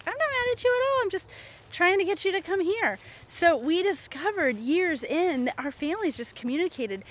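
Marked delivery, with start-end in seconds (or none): 0.1-3.0 high-pitched mock protest; acted exasperated explaining, pitched way up; insistent denial. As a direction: imitate yourself defending against the charge. Retold wife denying that she is mad.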